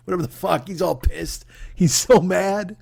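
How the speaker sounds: ordinary delivery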